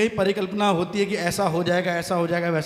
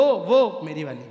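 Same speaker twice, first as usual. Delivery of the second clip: normal talking voice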